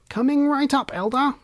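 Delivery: high-pitched, cheery helper's call; eager elf server. A narrator's reading. Elf answers the Elder's order for chocolate, eager high-pitched voice.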